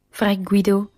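ordinary delivery

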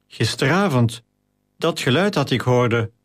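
plain reading voice

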